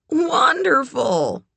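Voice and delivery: high, drawn-out delight; delighted, lingering on the word; pleased. A narrator's reading. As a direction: gush it slowly, pitch way up. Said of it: "looking pleased".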